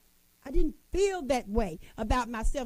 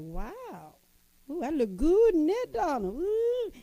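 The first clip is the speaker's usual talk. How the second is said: high, delighted coos at my own reflection; purring over how good I look; admiring myself in the mirror; 5 semitones higher than usual. Retold or imitated. Preacher admiring herself in the mirror, delighted high coos. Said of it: "I was in the mirror like this".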